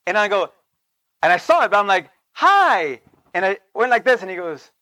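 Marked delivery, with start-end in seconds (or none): 2.3-3.0 higher, eager friendly hi; cheerful hopeful hi; bright hello. Retold or imitated. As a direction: greet him like an old friend. Greets former pastor warmly.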